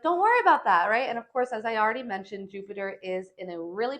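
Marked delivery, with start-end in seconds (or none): 0.0-0.9 high, loud breezy brush-off; carefree mock quote. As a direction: toss it off like nothing matters. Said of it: "all you Jupiter ruled signs are like".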